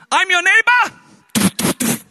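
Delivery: high, loud, very slow calling out. Retold or imitated. On the neighbour's balcony at night, a loud slow high call. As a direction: call through the curtain to be let in.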